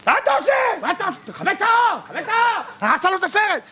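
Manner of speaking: high, indignant cry to turn off the light; angry viewer. Retold or imitated. Viewer shouting at the intruder to turn off the light, high and indignant. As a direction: snap at the man who ruined the movie. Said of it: "למה הוא צועק?".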